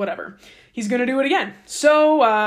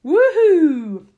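higher, louder woohoo; mock cheer; 6 semitones higher, 5 dB louder than usual. Moses congratulating the Levites, mock cheerful woohoo. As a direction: cheer them on, tongue in cheek.

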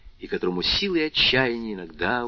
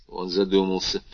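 plain reading voice

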